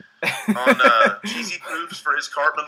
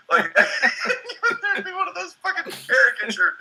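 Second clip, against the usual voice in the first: laughing; much slower than usual. Real, not acted. Laughing through the caricature bit.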